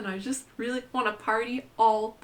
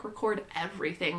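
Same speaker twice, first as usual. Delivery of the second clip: ordinary delivery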